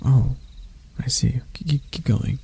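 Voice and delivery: breathless soft whisper; hushed, embarrassed urging; flustered whisper. Acted. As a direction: whisper it, caught off guard. Flustered prince urging prisoner on.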